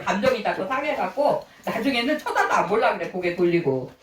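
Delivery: ordinary talk, nothing marked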